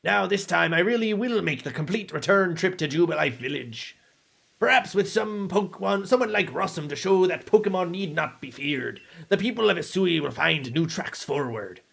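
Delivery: earnest young villager, lightly voiced; game character voice. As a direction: read the NPC line in character.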